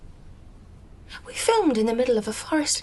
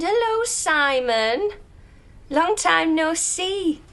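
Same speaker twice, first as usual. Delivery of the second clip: high, slow, drawn-out hello; effusive greeting; 7 semitones higher, 3 dB louder, much slower than usual. Acted. Actress greeting Simon at the door, effusive. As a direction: draw out each word in a raised, gushing greeting.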